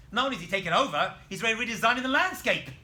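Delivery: loud, fast mock outrage; hammed-up indignation; indignant king.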